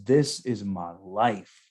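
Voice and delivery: "This is my life" drawn out very slowly; slow quoted drunk marine. Retold or imitated. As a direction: linger on each word like a drunk friend. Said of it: "He goes".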